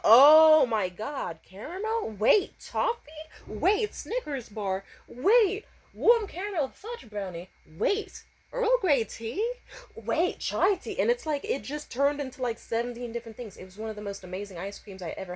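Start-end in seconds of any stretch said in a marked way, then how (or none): 0.0-11.0 amazed tasting. Retold or imitated. Tasting flavor-changing ice cream.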